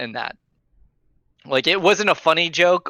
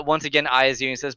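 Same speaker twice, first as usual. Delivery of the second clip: ordinary talk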